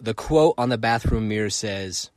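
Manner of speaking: ordinary talk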